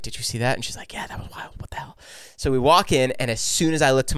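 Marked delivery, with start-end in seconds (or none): 0.0-2.0 hushed, furtive mock exchange; imitated under-the-breath astonishment; retold whispering. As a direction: whisper it like stunned friends in line. Furtive exchange between two friends retold.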